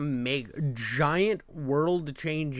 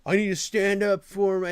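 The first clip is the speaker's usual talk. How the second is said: mock child; 6 semitones higher, 6 dB louder than usual. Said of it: "like a fucking five-year-old".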